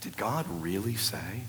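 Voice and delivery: softly voiced tempter; lightly acted serpent, quiet and questioning; serpent's question. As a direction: ask it softly, as if planting a doubt. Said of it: "First words out of his mouth".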